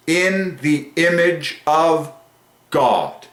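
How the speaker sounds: drawn-out, reverent declaration; awed proclamation. Real, not acted. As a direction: stretch each word, pause before God. Awed repeat of the famous phrase.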